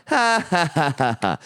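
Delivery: mock audience laugh starting high, then dropping; imitated settling laugh. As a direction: act out a crowd easing into laughter. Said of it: "and then settled into".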